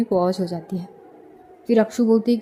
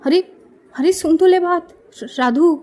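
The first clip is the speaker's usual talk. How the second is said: raised calling voice; shouting after a friend who hung up; 7 semitones higher, 5 dB louder than usual. A narrator's reading. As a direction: call after her friend loudly. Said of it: "इधर अक्षु चिल्लाती है".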